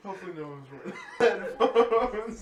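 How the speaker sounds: laughing. Real, not acted. After a loud noise, laughing.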